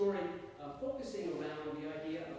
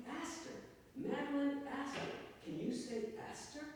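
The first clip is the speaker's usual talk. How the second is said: much higher-pitched lady coaching a parrot; society lady; 11 semitones higher than usual. Acted. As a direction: prompt a bird to repeat your name.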